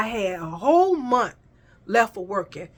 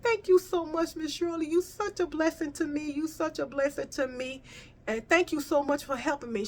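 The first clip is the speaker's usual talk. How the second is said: higher, softer, heartfelt thanks; retold gratitude; 6 semitones higher, 5 dB quieter than usual. Job seeker thanking Miss Shirley for the new job, grateful. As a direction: replay your thanks gently, pitched up.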